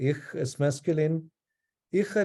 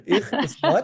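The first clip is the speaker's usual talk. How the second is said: higher and louder, with a laugh in it; laughing self-correction; 9 semitones higher, 7 dB louder, slower than usual. Shown as a demonstration. Teacher catching her own slip, higher and louder with a laugh. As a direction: blurt out "Ich is what?" as the fixed version, amused. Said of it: "Oh, sorry".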